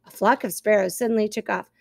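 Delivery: plain reading voice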